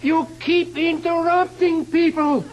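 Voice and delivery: high, loud charge; loud accusing announcement; loud accusation. Acted. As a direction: shout the crime. Executioner names the crime.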